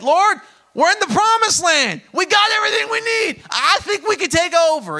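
loud, high, cocky shout as Israel; boastful shout. Acted. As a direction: shout it like a proud people who think they can win alone. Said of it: "Israel said".

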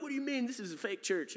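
high, baffled retort; high, puzzled visitor protesting; baffled reply. Retold or imitated. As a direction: act genuinely confused, as if they're the odd ones. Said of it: "And he's like".

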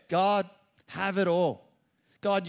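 fervent prayer cry. Real, not acted. Pastor surrendering to God, slow fervent cry. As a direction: cry out and give it all up.

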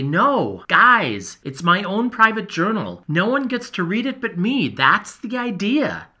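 firmly guarding his private journal, a bit fed up; hint of frustration. A narrator's reading. Journal writer refusing his curious friends, frustrated. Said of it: "a hint of frustration creeps in".

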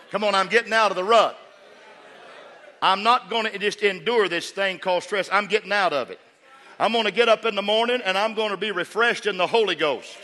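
loud, high shouted preaching; preaching at full force. Acted. Preacher rousing the congregation out of their rut, shouted and high. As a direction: whoop it out to lift the crowd.

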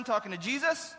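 ordinary talk, nothing marked

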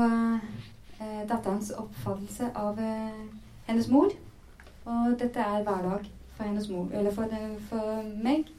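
ordinary delivery

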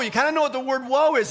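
ordinary talk, nothing marked

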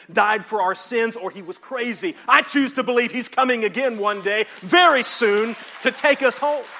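building to a loud, high climax; melodramatic preaching. Real, not acted. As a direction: proclaim it with swelling fervour to the congregation. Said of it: "not to be melodramatic".

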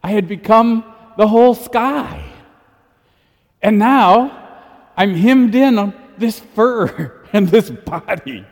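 lightly voiced cat longing for the sky; a hint of a cat mourning its lost vastness; cat in the poem. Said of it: "And my cat said".

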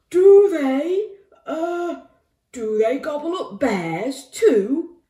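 high, loud, hesitant alarm; nervous bear. A narrator's reading. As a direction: falter like a worried bear. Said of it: "asked the bear nervously".

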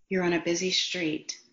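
normal talking voice

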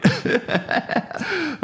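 high giggling laugh; stoner giggle. Real, not acted. Laughing after "fuck it.

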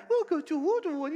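put-on very high, loud cooing to a baby; crazy baby talk. Preacher mimics adults meeting a baby, high-pitched crazy baby talk. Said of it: "start talking that crazy talk".

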